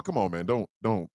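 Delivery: the boap bird begs the snake man to stop, low and soft; pleading bird voice. Acted. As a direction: beg like a worried little bird. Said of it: "The boap bird was outside going".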